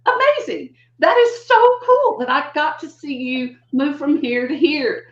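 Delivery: teacher marvelling at a student's breakthrough; delighted amazement. Retold or imitated. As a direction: gush with joy at their progress. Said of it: "you're just like".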